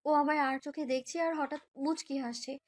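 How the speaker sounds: ordinary delivery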